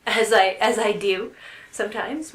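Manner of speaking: loud, laughing aside; amused admission, laughed out loud. Real, not acted. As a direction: say it through a burst of laughter. Laughs while admitting her habit.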